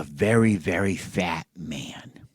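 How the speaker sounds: slow, gossipy whisper. Acted. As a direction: whisper it slowly like juicy gossip. Mocking king in story.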